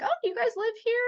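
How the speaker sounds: put-on high-pitched curious kid's question; little kid. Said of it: "Some little kid was like".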